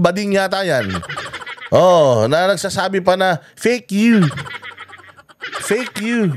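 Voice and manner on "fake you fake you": higher-pitched mock taunt; mocking imitation. Vlogger mimics a congressman's taunt, mocking imitation. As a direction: mimic the jeer, drawn up high. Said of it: "Gumaganong-ganon pa".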